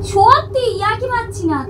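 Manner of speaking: high, loud protest that it's true; half-playful insistence to the child; mock insistence.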